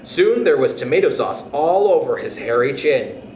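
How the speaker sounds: ordinary delivery, nothing marked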